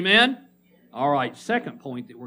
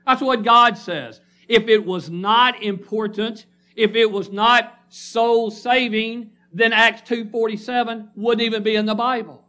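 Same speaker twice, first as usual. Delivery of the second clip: forceful preaching; 5 semitones higher, 4 dB louder than usual. Acted. Preacher driving home a point to the congregation, loud and emphatic. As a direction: hammer the point home from the pulpit.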